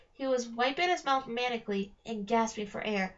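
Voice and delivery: ordinary delivery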